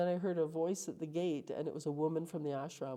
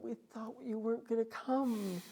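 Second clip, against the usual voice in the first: lightly voiced priest, higher pitched; a hint of a relieved priest, voice lifted higher; 8 semitones higher than usual. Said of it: "the priest went".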